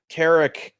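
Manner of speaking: ordinary talk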